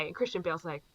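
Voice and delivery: normal talking voice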